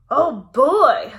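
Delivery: big booming dinosaur voice; loud booming. A narrator's reading. Papa Dinosaur announcing to his family, loud and booming. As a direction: boom it out like a huge papa. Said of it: "in his loud, booming voice".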